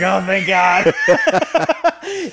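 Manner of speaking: high, loud, drawn-out relief; hammed-up grateful exclamation; mock relief. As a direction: imitate people hugely relieved at a rescue. Quoting desperate people greeting their rescuer.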